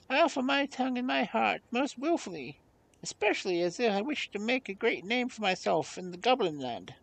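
high, squeaky monkey voice; volunteer in a high little monkey voice; eager pet monkey. Acted.

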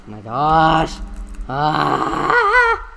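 loud, drawn-out cry of frustration; loud exasperated holler; voice-straining yell. Real, not acted. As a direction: yell until your throat hurts. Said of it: "my voice really hurts now".

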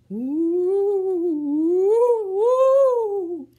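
very high, loud eerie ooh; ghostly hooting. Acted. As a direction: hoot like a ghost. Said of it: "an eerie ghost sound".

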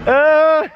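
sharp high cry of pain; pained yelp. Real, not acted. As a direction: yelp as the tongue pulls. Tongue stuck, pained yelp.

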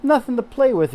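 high, loud astonished cry; shocked she has no toys; exclaiming. Acted. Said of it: "exclaimed Martha".

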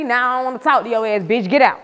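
mock angry snap. Acts her angry reply.

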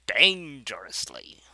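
DANGEROUSLY stretched slow, loud start fading to breath; slow "Dangerously", first syllable loud, the rest trailing into whisper; drawn-out dramatic emphasis. Acted. Repeats own word for effect.

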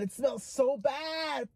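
slow loud groan of disgust; disgusted shout. Real, not acted. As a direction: yell it out, revolted. Gagging over the candy.

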